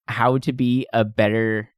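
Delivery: ordinary delivery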